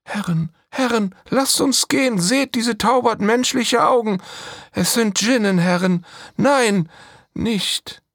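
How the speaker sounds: high, loud panicked warning; frightened cry. Acted. Slave girl begging her masters to flee in fear. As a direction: shout a desperate plea to leave. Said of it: "Mirhalla warns again".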